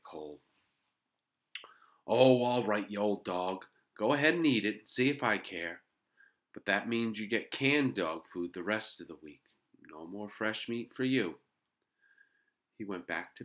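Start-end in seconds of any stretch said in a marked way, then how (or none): 2.1-11.4 put-on loud, huffy boy telling off his dog; grudging scolding. A narrator's reading. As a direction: give in crossly, louder and higher.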